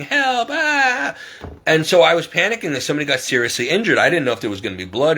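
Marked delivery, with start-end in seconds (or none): 0.0-1.1 very high mock scream for help; imitated crying scream. Imitating the injured neighbor calling for help, crying scream. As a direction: wail it high, imitating someone hurt. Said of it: "crying and screaming".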